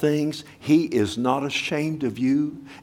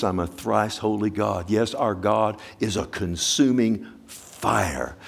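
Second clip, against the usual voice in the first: ordinary talk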